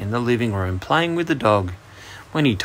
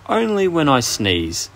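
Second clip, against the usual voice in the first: higher, slow and a little sniffy; sniffy dragon; 6 semitones higher, 3 dB louder than usual. A narrator's reading. Dragon explains its fire-breathing to the boy, sniffily. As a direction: answer slowly with a touch of a sniff. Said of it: "sniffed the dragon".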